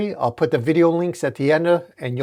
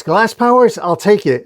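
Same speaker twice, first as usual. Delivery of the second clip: high, loud surprise then acceptance; loud incredulous exclamation; excited yell; 8 semitones higher, 6 dB louder than usual. A narrator's reading. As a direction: yell out, seizing the chance. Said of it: "He yells".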